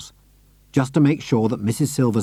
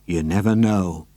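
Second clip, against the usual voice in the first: lower, ominous repeat; dark and knowing; 4 semitones lower than usual. A narrator's reading. Mister Hoppy hinting at his secret, low and dark. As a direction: let the hint hang darkly. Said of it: "said darkly".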